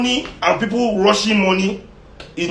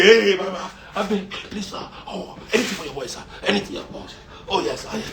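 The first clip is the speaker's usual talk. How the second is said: soft, laughing beggar voice, much quieter; mock grovelling; 6 dB quieter than usual. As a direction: grovel softly while laughing. Mocking begging rich man.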